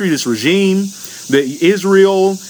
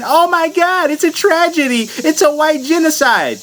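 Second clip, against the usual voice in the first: high, sarcastic mock-horror voice, much higher; mock outrage; 11 semitones higher, slower than usual. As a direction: ham up fake shock at a tragedy.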